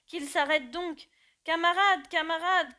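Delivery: high, loud pleading shout; crying out. Acted. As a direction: beg the horses to stop. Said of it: "criaient les animaux".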